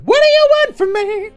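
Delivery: ordinary talk, nothing marked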